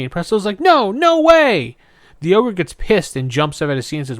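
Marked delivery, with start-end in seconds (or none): 0.6-1.8 much higher, slow and drawn out; high cartoon refusal. Host voicing Presto refusing the ogre's bet, high and slow. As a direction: stretch out a squeaky refusal.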